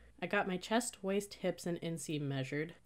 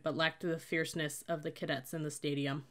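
ordinary delivery, nothing marked

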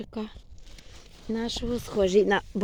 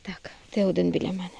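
ordinary talk, nothing marked

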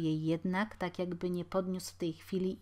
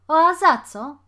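very loud, high indignant question; defiant challenge; 9 semitones higher, 16 dB louder than usual. A narrator's reading. Younger boy disputing the threat, defiant and loud. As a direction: demand a reason.

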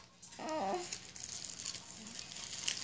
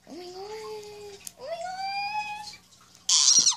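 excited squeal; 12 semitones higher than usual. Real, not acted. Notices something cute.

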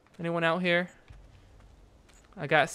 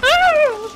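loud high-pitched scream of fright; real shriek of shock; startled scream; 14 dB louder than usual. Real, not acted. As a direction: scream at a jump scare. Jump scare in horror game.

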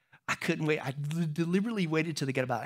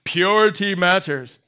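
mock teen disgust; 8 dB louder than usual. Teen voice continues.

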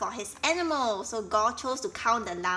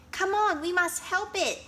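urgent call; 9 semitones higher, 4 dB louder than usual. Acted.

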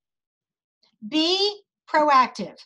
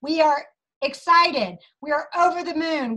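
ordinary delivery

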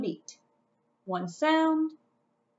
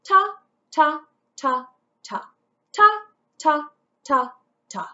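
each ta spoken on the beat; rhythm syllables; 3 semitones higher, 7 dB louder than usual. Shown as a demonstration. Teacher modelling quarter notes for the class. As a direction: chant ta evenly, one per beat. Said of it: "read it twice with me".

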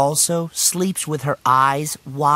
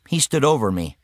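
ordinary delivery, nothing marked

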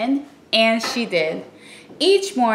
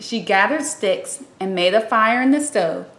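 usual speaking voice

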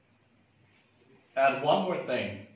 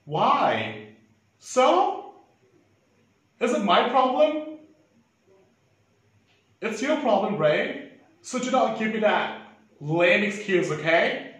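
furious shouting, higher and louder; angry yelling; 7 semitones higher, 4 dB louder than usual. Acted. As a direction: blow up at them in full rage. Boss blows up at two staff.

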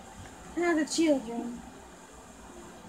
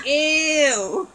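sharp, louder ew of disgust; 4 semitones higher, 7 dB louder than usual. Acted. Doll reacting to something gross, loud disgust.

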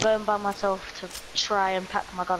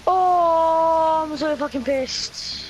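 real loud burst of rage, higher; furious outburst; 5 semitones higher, 4 dB louder, much slower than usual. Real, not acted. Gamer who just lost all his gear, furious outburst. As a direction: explode at losing everything.

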